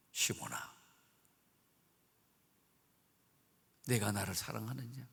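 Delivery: low, soft voice of Jesus; low quiet tender question; gentle Jesus. Acted. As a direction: ask it tenderly, almost hushed. Risen Jesus asks Peter.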